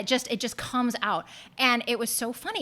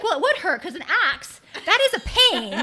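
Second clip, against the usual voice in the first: put-on child's logic, laughing; 9 semitones higher, 7 dB louder than usual. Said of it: "And she's like".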